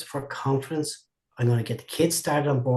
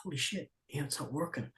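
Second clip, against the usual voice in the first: muttered aside; 14 dB quieter, faster than usual. Retold or imitated. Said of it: "and I go".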